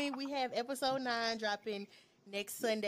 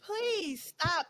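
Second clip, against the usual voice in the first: exasperated; 4 semitones lower, 5 dB louder, much slower than usual. Retold or imitated. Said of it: "And I'm just like".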